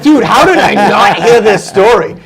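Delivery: high, loud incredulous outburst with laughter; retold astonishment. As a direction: demand to know, amused and amazed. Retells own amazed reaction to friend.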